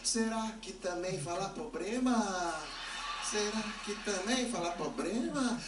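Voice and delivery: slow, drawn-out agonised question; dreading wonder. Acted. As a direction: stretch out "problema" as "pobrema" in comic dread. Dreading his next mispronounced word.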